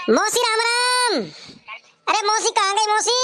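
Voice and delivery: long high holler for auntie; calling out. Acted. Visitor hollering for auntie at her house, calling out. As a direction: call out slow and high-pitched. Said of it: "अरे कौन चिल्ला रहा".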